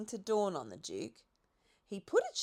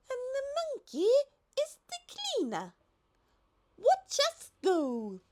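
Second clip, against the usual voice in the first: much higher, light giraffe voice; 12 semitones higher, 4 dB louder than usual. A narrator's reading. The giraffe reminds the Duke of his invitation in a high voice. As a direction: play the lofty giraffe.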